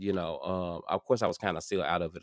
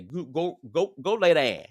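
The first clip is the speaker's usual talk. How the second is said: bossy viewer voice; 8 semitones higher, 4 dB louder than usual. Retold or imitated. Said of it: "somebody gonna watch this and say".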